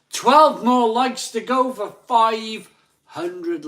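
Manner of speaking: high, loud, drawn-out hype; big slow countdown call; rallying announcer shout. Real, not acted. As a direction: stretch it out loud to rally the crowd. Hyping viewers towards like target.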